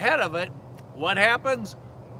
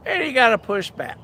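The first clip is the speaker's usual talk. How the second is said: ordinary delivery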